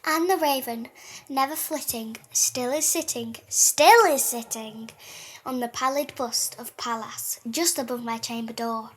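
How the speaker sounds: measured, slightly grand poem reading; dramatic reading of The Raven; poem recital. Acted. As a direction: read it like a gothic recital. Reads Poe poem aloud.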